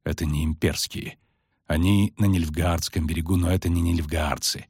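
low, sombre knight's voice; gloomy. A narrator's reading. Knight identifies the riders on the bank, gloomy. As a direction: drop your pitch and state it glumly. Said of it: "угрюмо сказал Кагыр".

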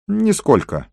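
high-pitched, hurried dismissal; hasty denial. A narrator's reading. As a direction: jump in high to deny it. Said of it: "поспешно ответил он".

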